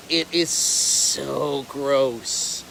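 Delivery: slow, low, drawn-out disgust. Acted. His other half reacts to alligator poo, mock disgusted. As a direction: stretch out the word so in mock revulsion.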